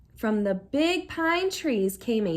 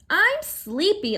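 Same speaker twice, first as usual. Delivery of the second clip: sleepy complaint; 7 semitones higher, 4 dB louder, slower than usual. A narrator's reading. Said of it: "Uncle Phil complained".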